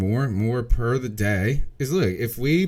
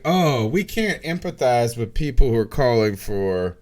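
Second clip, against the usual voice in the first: sarcastic mock plea, rising to a louder finish; mocking mimicry; 4 semitones higher, 4 dB louder than usual. Guest mocking those who empathize with the powerful, sarcastic. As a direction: parody their misplaced sympathy, building in pitch and force. Mocking society's misplaced sympathy.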